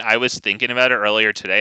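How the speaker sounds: ordinary talk, nothing marked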